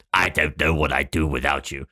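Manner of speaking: hammed-up sinister narcissist voice; Darth Sidious. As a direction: lay on a creepy villain voice. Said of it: "sorry, I didn't use my narcissist voice".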